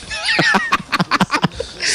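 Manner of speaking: high-pitched burst of laughter; high giggling laugh; real laughter. Real, not acted. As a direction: crack up laughing, high and helpless. Cracks up over the hint word.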